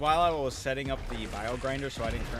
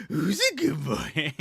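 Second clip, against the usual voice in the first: slow sing-song, a bit louder; SpongeBob pet voice; 3 semitones higher, 4 dB louder, slower than usual. Retold or imitated. SpongeBob praising his pet, slow goofy sing-song. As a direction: stretch it out with a goofy face. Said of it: "he makes the stupidest face and he goes".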